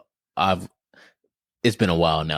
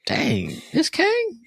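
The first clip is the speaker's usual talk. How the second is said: incredulous; much slower than usual. Retold or imitated. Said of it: "it was like".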